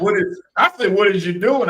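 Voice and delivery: high, loud repeated call; loud retold question. Host repeating what he shouted at cohost, high and loud.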